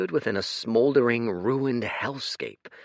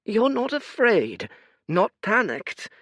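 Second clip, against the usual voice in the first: put-on high, tired old man voice; a sorrowful old oracle; weary old seer; 13 semitones higher, 4 dB louder than usual. Fate's voice.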